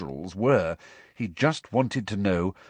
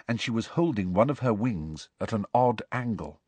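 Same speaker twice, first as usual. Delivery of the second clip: plain reading voice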